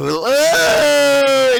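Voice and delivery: loud, high shriek of disgust; real shocked yell at the screen; disgusted shout. Real, not acted. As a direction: scream the ew in pure disgust. Reacting in shock to the clip.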